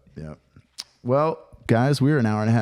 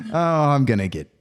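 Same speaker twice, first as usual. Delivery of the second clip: ordinary delivery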